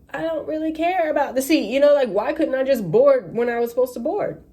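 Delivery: high, fed-up plea; why can't I just board; exasperated passenger. Retold or imitated. Waiting passenger to the gate agent, high exasperated plea. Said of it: "she's looking like".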